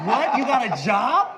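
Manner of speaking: loud, high mock outburst; astonished. Executive learns the kid has to get to work, astonished. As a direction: blurt it in hammed-up disbelief.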